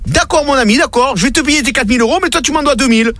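high indignant protest; outraged customer. Retold or imitated. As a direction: squeal it in outrage over the bill. Maurice répond au directeur. (client scandalisé, voix très aiguë)

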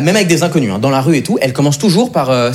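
ordinary talk, nothing marked

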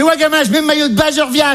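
high, cheeky mum voice; mock mum, high and eager; mother's voice. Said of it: "Elle fait".

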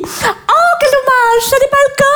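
high-pitched, mock-sympathetic cooing; competitive mum's pity. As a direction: fake pity for the rival mother's child. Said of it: "les mamans compètes".

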